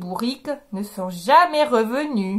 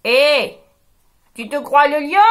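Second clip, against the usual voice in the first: mild teasing dog voice; the dog calls her out; dog retorting; 4 dB louder than usual. Acted. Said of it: "répond le chien". (le chien qui se moque, un peu)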